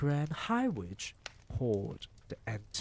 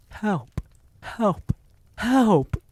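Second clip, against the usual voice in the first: each cry higher and louder, to a full shriek; building terrified screams; 11 semitones higher, 11 dB louder, much slower than usual. A narrator's reading. Cornered boy screaming toward the doors, panic building. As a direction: scream for anyone outside. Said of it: "I began to scream".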